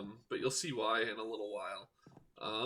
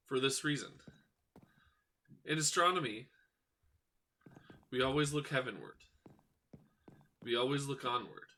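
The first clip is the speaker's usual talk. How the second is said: solemn speech. Acted. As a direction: speak like a wise sage. Solemn closing monologue.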